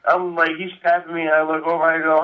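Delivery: ordinary talk, nothing marked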